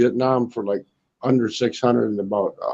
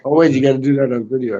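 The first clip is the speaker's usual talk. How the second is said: ordinary talk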